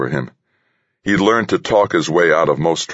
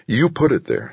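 higher-pitched, insistent pushback; 10 semitones higher than usual. A narrator's reading. Harry pins the blame on his crewmate, insistent. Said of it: "Harry insisted".

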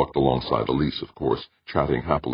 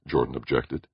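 ordinary delivery

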